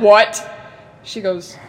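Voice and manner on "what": loud, disbelieving what, laughing; shocked what. Retold or imitated. Daughter to mom on hearing she's German, loud shocked what. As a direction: blurt it out in mock shock.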